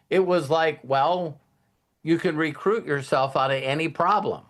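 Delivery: normal talking voice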